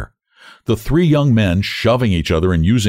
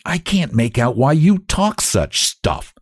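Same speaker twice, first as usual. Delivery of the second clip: higher, slow irritated retort; moderately angry, drawn-out scorn; angrily; 6 semitones higher, slower than usual. A narrator's reading. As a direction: snap at a boasting friend, words stretched. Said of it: "says the other tall one angrily".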